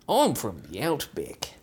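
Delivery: put-on slow, shaky-hold Australian accent; slipping Australian accent. Performer losing the accent, slow Australian attempt. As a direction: stretch out a failing Australian. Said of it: "Losing the accent".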